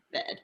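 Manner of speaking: bed, jaw dropped and mouth kept flat; bed, open flat E. Shown as a demonstration. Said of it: "a jaw drop and our mouth stays flat".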